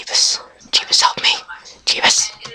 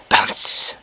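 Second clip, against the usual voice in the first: unvoiced whisper to oneself; whispered echo. Real, not acted. Player echoing the bounce to himself, whispered. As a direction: whisper it under your breath.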